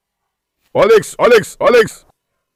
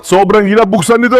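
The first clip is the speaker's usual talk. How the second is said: ordinary talk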